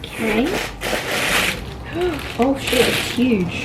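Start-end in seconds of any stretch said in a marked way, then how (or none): 0.5-2.3 sharp unvoiced gasp of awe; a breathy high gasp at the size. Real, not acted. Opening a huge package, she gasps in awe.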